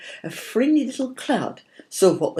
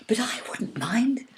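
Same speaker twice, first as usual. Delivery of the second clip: mild whisper; 8 semitones higher than usual. A narrator's reading. Fairy pleads to the cloud.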